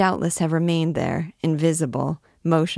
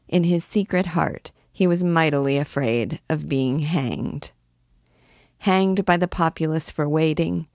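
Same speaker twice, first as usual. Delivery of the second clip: plain reading voice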